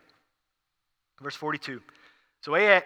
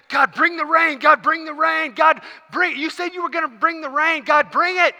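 high, loud, repeated plea; impassioned acted prayer; begging God; 10 semitones higher, 4 dB louder than usual. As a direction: plead fervently, insisting harder with each phrase. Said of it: "he starts begging God again".

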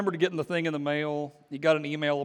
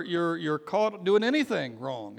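ordinary talk, nothing marked

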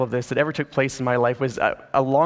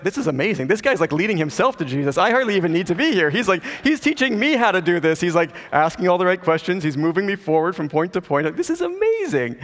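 rising giddy amazement, peaking high at the end; excited inner thought; 4 semitones higher than usual. Retold or imitated. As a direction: build up thrilled disbelief. Pastor's inner excitement retold.

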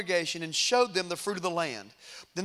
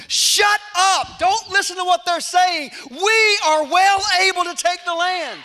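full-volume, high rallying yell; defiant shout; 10 semitones higher, 10 dB louder than usual. Retold or imitated. Caleb silencing the fearful spies before the people, defiant shout. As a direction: shout down the doubters, loud and sure.

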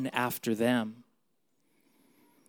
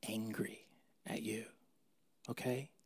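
whispered reassurance to the congregation; hushed comforting whisper; 12 dB quieter than usual. Real, not acted. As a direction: lean in and whisper it.

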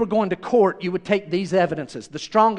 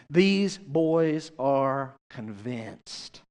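drawn out word by word, a little lower; slow, weighty; 5 semitones lower, 4 dB quieter, much slower than usual. Real, not acted. Preacher sums up the disciples' certainty, slow and weighty. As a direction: land it very slowly, letting it drop away. Preacher lands his conclusion slowly.

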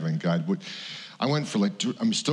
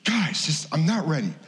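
high, strained not-ready plea; pleading protest; 8 semitones higher than usual. Retold or imitated. [name] pleading with the pastors, high and strained. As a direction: beg them to back off. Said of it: "And I'm going".

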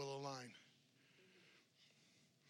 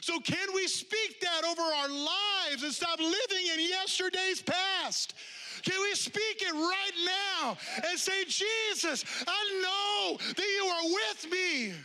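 high, loud, stretched-out preaching; preaching at full force; 12 semitones higher, 7 dB louder, slower than usual. Acted. Pastor leading the congregation in declaration, full-force preaching. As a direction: lift the room with a slow, soaring call.

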